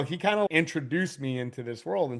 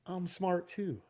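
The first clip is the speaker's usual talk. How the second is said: small, higher, soft voice; meek little voice; 5 semitones higher, 7 dB quieter, slower than usual. Acted. Business owner voicing his inner reply to experts, meek. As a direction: pipe up softly like an overlooked kid.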